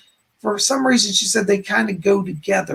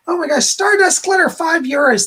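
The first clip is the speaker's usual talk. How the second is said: high, loud and thrilled; excited donation shout-out; 8 semitones higher, 5 dB louder than usual. Real, not acted. Psychic thanks a viewer for a donation, thrilled and loud. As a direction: squeal the donor's name with delight.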